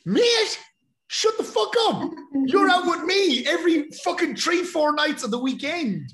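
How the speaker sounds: yelling high and loud at his mate; retold furious shout. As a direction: blast it at him, fed up with his lie. Calling out mate's lie.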